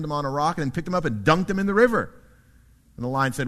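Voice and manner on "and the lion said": usual speaking voice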